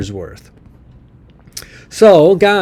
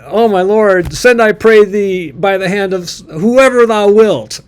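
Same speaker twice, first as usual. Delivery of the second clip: pleading Moses; 6 semitones higher, 4 dB louder than usual. Acted. Moses begging God not to send him.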